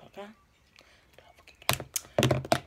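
slow breathy whisper. Real, not acted. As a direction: whisper it slowly under your breath. Quick whispered aside to self.

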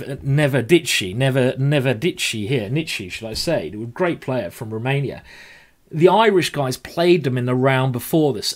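usual speaking voice

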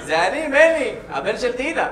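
high, projected self-introduction; calling up. Acted. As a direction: call up to someone at a window. Identifies himself up to window.

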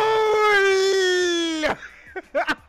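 big happy laugh after scoring; loud delighted laugh. Real, not acted. Laughing after goal yell.

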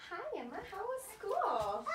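warm greeting. Real, not acted. Therapist greeting a young patient, high and slow. Clinician greets child warmly.

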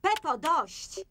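sharp scolding. Acted. Mother stops naughty child.

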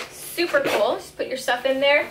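normal talking voice

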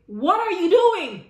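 loud, slow, high call from across the room; surprised shout. Retold or imitated. Salon owner calling out across the room, loud and slow. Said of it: "I heard a voice say".

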